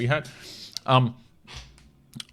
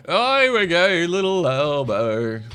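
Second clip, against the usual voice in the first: put-on baby talk; 6 semitones higher, 6 dB louder, slower than usual. Cooing at dog video.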